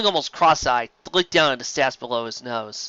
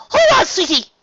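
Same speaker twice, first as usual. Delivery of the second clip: very high, loud reassuring yell; much higher, louder urgent call; urgent cry; 9 dB louder than usual. Acted. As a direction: yell it over the river. Rarity in peril.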